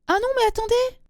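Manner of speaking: high-pitched startled interruption; sudden alarm. Real, not acted. Host realising a mix-up mid-reading, high startled voice. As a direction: break off in dismay. Realises she has wrong mail.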